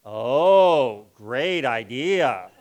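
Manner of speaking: slow, dry and mocking; dripping sarcasm with a laugh; sarcastic. Shown as a demonstration. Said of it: "something called sarcasm".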